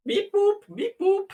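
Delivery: very high beeping robot. Pretend. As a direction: squeak it out like a toy robot. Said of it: "everything just got real robot for me".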